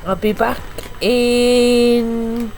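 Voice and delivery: talking while eating crisps; words round a mouthful of crisps; mouth full of crisps. Real, not acted. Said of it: "I'm eating crisps".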